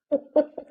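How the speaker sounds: short laugh; real laugh. Real, not acted. Telling listeners about her best friend, laughing.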